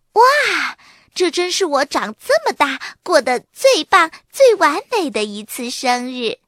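high, loud delighted exclamation. A narrator's reading. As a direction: gush with joy over the party. Birthday child delighted by surprise. (惊喜万分地高声感叹)

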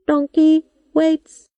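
high, slow, even machine voice; computer voice. A narrator's reading. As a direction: speak like a patient old robot. Robot computer speaks.

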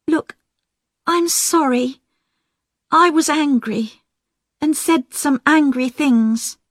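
a hint of a contrite sister making amends; Diane, sorry. A narrator's reading.

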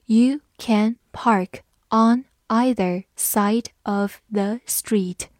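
slow, careful reading of "You can park on either side of the street". Shown as a demonstration. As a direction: read it slowly so learners can follow. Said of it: "我们慢读一遍".

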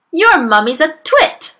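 high, loud scornful bellow. A narrator's reading. Trunchbull insulting Amanda's mother, bellowing. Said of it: "the Trunchbull bellowed".

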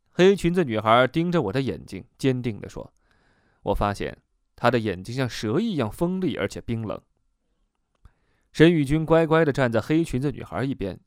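usual speaking voice

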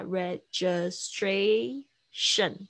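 "registration" slowly as re-gi-stra-tion; syllable by syllable. Shown as a demonstration. Teacher breaks down "registration" for students, syllable by syllable. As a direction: draw out four separate beats in "registration". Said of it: "它有四个音节".

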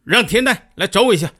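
loud, high, irritated command; king barking for his minister to come; angry summons. Retold or imitated. King angrily orders Tian Dan summoned to him. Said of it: "火气冲冲地说".

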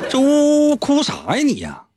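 high, slow exasperated scolding; annoyed friend. Retold or imitated. Friend scolding Flaubert for crying, mock exasperation. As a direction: nag him for crying.

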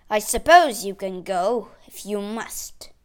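grudging permission, loud at first then dropping; cross. A narrator's reading. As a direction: give in crossly, slowing at the end. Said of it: "he said crossly".

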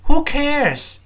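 "who cares" projected with a falling end; read aloud boldly. Shown as a demonstration. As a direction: call it out for the class to repeat. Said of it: "我们大声读一遍".

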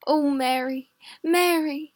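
a frightened husband near tears; mildly tearful voiced husband; crying husband. A narrator's reading. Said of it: "Why are you crying?".

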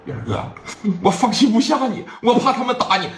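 put-on high, anxious girlish voice; high girl voice full of worry; worried girl. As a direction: plead out of worry for him.

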